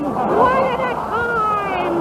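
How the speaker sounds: very slow, stretched-out repeat; drawn-out punchline. Acted. Repeating the punchline to the audience, very slow and drawn out. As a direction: drag it out so the joke lands.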